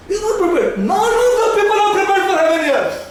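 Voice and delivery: high, emphatic sermon voice; preaching rebuke. Real, not acted. Preacher telling the church nobody is prepared, high-pitched rebuke. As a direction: rebuke the whole church from the pulpit.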